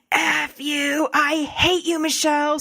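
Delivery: high, mock-angry yelling, half-acted; hurling hate back at him, a hammed-up inner child; retold angry yell. Inner part yelling at Michelle, a mock scream. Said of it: "screaming at me".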